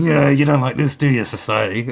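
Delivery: put-on loud, goading teen taunt; angry teenager. Angry teenager provoking society, loud and taunting. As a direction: throw it defiantly at everyone. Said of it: "this angry teenager going".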